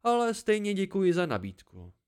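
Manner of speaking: a lively hermit delighted to see company; the old listener welcoming a weary traveller; cheerful old hermit. A narrator's reading. Old man's voice.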